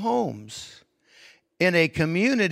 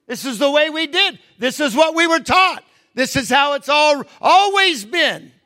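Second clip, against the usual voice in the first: high, emphatic mock protest; insistent traditionalists; 11 semitones higher, 5 dB louder than usual. Closed community defending its customs to an outsider, insistently. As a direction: imitate people insisting on their old ways.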